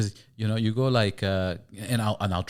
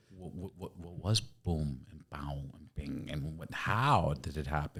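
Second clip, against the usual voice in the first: confused American; 5 semitones lower, 6 dB quieter, slower than usual. Retold or imitated. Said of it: "Americans are like".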